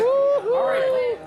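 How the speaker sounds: excited cheer. Real, not acted.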